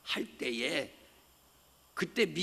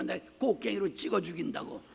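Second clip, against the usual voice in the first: usual speaking voice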